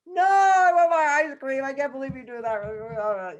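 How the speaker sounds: high, loud wail of protest; hammed-up mock tantrum, starting at a shriek and easing down; angry child tantrum. Daughter refused ice cream by her father, angry tantrum. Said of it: "she's getting all angry".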